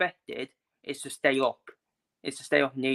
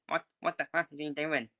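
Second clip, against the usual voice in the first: mock American owner's accent, a bit softer; bad American accent; 6 dB quieter than usual. Club owners fuming at the manager, in a shaky-quality American accent impression. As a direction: put on a poor American accent. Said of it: "In their American accent".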